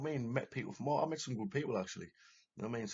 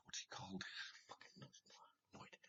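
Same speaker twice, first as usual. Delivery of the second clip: muttering to himself; 16 dB quieter, faster than usual. Real, not acted. Trying to recall a name aloud.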